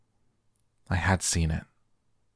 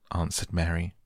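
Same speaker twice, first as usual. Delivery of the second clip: usual speaking voice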